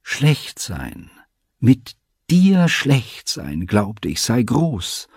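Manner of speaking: put-on bitter-emphatic young lover, key words pitched high; leidenschaftliche Elita. A narrator's reading. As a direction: dwell on the key words with rising passion. Elita's passionate outburst to father. (leidenschaftlich, mit gedehnter Betonung)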